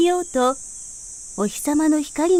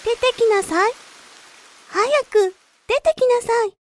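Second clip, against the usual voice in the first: higher coaxing voice urging; coaxing rain; 8 semitones higher than usual. A narrator's reading. Rain calling the root out of the soil, higher coaxing voice. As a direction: gently hurry the seed out, pitched higher. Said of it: "柔らかくして言いました".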